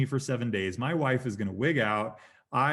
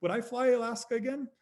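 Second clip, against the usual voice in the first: high, lilting self-question; musing question; 13 semitones higher than usual. Retold or imitated. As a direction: float it up high, pondering. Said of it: "to the point where I was like".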